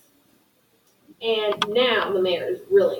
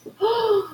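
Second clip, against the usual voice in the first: delighted gasp; 9 semitones higher, 3 dB quieter than usual. Real, not acted. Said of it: "What a great question".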